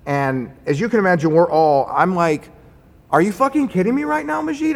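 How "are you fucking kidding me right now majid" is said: very high-pitched disbelief; retold incredulous outrage. Contractor to engineer who showed a gun, incredulous outrage. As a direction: relive the outrage, pitch shooting right up.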